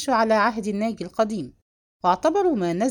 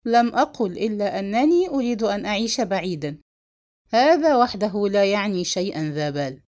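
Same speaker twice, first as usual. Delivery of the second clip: ordinary delivery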